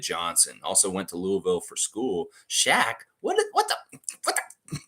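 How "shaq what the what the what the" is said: very high, breathy what-the; real shocked outburst; stunned disbelief. Real, not acted. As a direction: gasp it out in disbelief. Stunned reaction.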